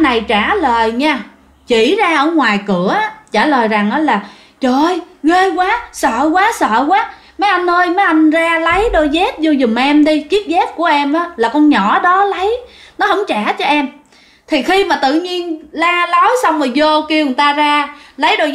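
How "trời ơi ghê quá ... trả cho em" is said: high, panicked pleading to the officers; terrified. Retold or imitated. Frightened mason begging the soldiers, high panicked voice. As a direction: blurt it out shaking with fright. Mason fleeing after seeing ghost.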